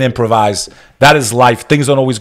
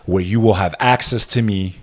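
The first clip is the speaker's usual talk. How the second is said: ordinary talk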